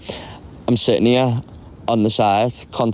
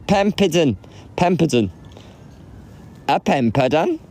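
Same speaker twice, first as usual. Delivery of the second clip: higher-pitched, careful stab at Welsh; attempted Welsh pronunciation; 6 semitones higher than usual. Shown as a demonstration. Testing a word on viewers, unsure Welsh accent. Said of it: "Is that Welsh?".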